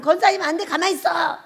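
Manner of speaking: bossy retort. Retold or imitated. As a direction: tell her firmly to keep still. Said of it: "막 이렇게".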